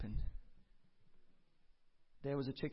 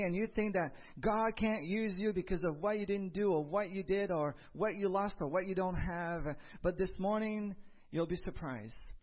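rising, full-voiced sermon delivery; preaching; 6 semitones higher, 5 dB louder than usual. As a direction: preach it with conviction to the congregation.

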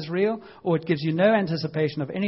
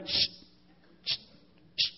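hushing; 9 dB quieter, faster than usual. Retold or imitated. Hushing again.

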